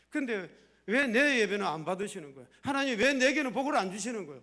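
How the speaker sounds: loud, whiny grievance to God; complaining prayer. Pretend. Pastor mimics a believer griping at God, loud and aggrieved. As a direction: protest loudly like a sulking believer.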